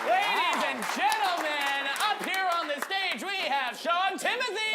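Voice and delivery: loud, high announcer intro; stage announcer. Real, not acted. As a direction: announce each name like a showman.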